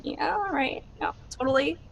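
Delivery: breezy, brushing-off agreement; mild teen brush-off; dismissive. Acted. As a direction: wave it away quickly. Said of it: "I'm like".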